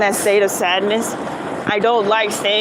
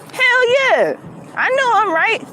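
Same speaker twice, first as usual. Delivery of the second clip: triumphant; 11 semitones higher than usual. Real, not acted. Vlogger proud she won the argument, high triumphant cheer. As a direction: crow about being right. Proud after the cop story.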